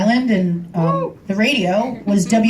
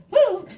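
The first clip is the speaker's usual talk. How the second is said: high mock howl answering back; wolf's howl back; 13 semitones higher than usual. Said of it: "he'd go".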